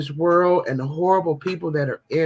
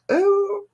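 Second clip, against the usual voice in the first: disgusted ooh. Real, not acted. Disgusted exclamation.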